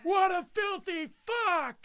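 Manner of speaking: high, loud, slow disgusted yell; outraged shout. Pretend. Yelling about the dildo finder in the courtyard, outraged shout. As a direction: roar your disgust. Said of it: "yelling it at the top of your lungs".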